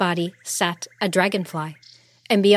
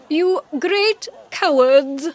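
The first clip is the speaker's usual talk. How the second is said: high, loud, slow queenly scolding; fierce rebuke; 9 semitones higher, 5 dB louder, slower than usual. A narrator's reading. Queen surging forward at the jeering soldiers, fierce. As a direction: hurl it slowly, high and loud.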